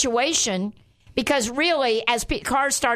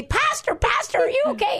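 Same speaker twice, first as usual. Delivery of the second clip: high, calling yell of concern; imitating a worried yell; 13 semitones higher than usual. Friend on speakerphone calling to her pastor, worried and high. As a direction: call out frantically over the phone. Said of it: "yelling at me".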